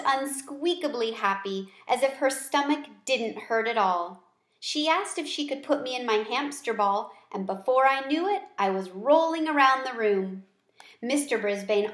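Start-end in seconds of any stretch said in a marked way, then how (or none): none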